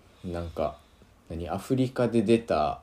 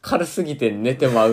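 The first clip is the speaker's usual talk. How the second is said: laughing; 5 semitones higher, 8 dB louder than usual. Real, not acted. Joke through laughter.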